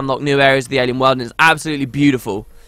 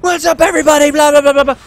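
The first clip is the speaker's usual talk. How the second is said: high, loud hyped-up streamer parody; over-the-top shouted intro, pitched way up; mock yelling; 11 semitones higher, 5 dB louder than usual. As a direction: yell it like a streamer pandering to twelve-year-olds. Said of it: "More yelling appeal to the twelve-year-olds".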